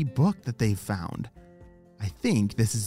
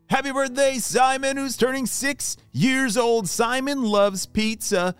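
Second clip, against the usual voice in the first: put-on kids show host; 3 semitones higher than usual. Birthday shout-out segment.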